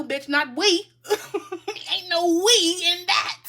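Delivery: loud and high, laughing through it; laughing outburst. Real, not acted. Reader laughing off mother's excuse to viewer.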